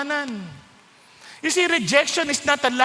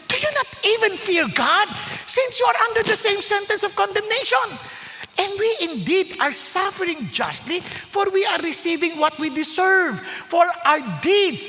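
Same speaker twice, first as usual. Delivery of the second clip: rebuking; 6 semitones higher than usual. Acted. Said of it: "and rebuking him said".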